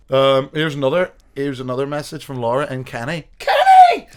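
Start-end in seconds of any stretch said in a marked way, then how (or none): 3.4-4.1 excited shout. Real, not acted. Laughing call-out of a name.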